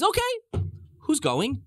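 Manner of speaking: high-pitched, agreeable question; negotiating Pharaoh. Retold or imitated. Pharaoh ready to negotiate with God, agreeable. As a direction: ask high, open to haggling.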